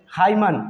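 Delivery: हाइमन in two syllables ending on N; wrong sounded N. Shown as a demonstration. Teacher showing the mistake, हाइमन with N. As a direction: pronounce the N in हाइमन. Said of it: "जो कि गलत sound है".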